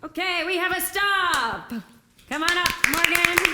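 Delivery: high, projected emcee shout; big game-host announcement; announcer call. Real, not acted. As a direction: call the next player up over applause. Host announces stop, calls up avatar.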